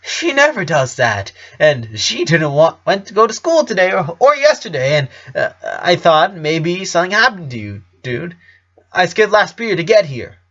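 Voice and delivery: put-on stammering nervous boyfriend, voice climbing; worried teen rambling, cracking upward; anxious teen boyfriend. Bobby panics over his girlfriend.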